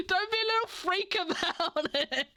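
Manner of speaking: giggly mock jab again; laughing repeat. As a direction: tease it through a laugh. Repeat of imagined mocking remark.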